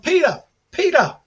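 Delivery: high, loud call for attention. Retold or imitated. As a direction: hail someone who has his back turned. Calling out to a celebrity's back.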